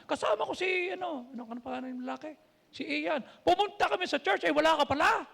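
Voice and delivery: high, loud chatter with a rushed middle; excited caller. Retold or imitated. Caller tells the pastor who came along, high and excited. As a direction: keep the excited chatter going. Acted phone call continues.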